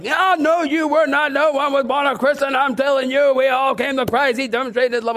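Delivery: hammed-up correction, pushing hard; insistent retort. Pastor insists to man after service.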